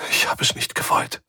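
toneless whisper to herself; barely audible whisper. Acted. Svensson on the floor whispers to herself. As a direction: breathe the words out, hardly audible. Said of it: "wisperte sie kaum hörbar vor sich hin".

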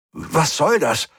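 a machine voice thrown off balance, calling out; deeply confused. A narrator's reading. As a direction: blurt it out in total confusion. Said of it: "rief die Stimme zutiefst verwirrt".

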